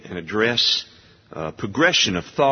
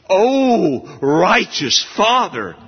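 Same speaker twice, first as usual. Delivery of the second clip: "Oh" lifted high and louder, "righteous Father" slowed; emphatic, emotional; 9 semitones higher, 5 dB louder, much slower than usual. Shown as a demonstration. Preacher voicing Jesus addressing his Father, emotional and emphatic. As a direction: cry out "Oh, righteous Father" with feeling. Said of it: "It's more emotional".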